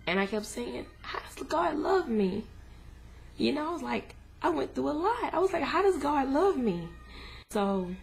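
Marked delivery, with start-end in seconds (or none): 1.0-2.4 anguished question. Retold or imitated. Angry at husband's comfort. 5.6-6.9 anguished question. Retold or imitated. Repeated in grief.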